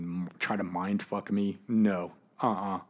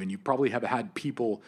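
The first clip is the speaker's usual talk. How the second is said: normal talking voice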